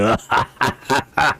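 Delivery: staccato crazed-dwarf laugh; theatrical lunatic chuckle; mad cackle. Acted. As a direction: break from lament into cackling. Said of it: "this dwarf is quite mad".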